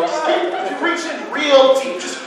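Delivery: shrill comic squeal; squealing high. Acted. Comic sensitive guy bit.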